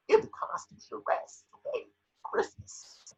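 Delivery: whispered, menacing aside; hushed threat. Acted. Shrek silencing the reporter, a whispered threat. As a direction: hiss it right into his ear.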